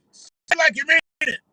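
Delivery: high, loud, fast preacher shout; mock preacher exhortation; preacher call. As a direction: fire the crowd up. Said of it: "So [name] can switch it up".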